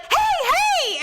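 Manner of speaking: high, loud re-enacted shout; urgent calling out. Wife trying to wake her sleeping husband, urgent calling out. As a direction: call out sharply to rouse someone. Retelling waking a thrashing husband.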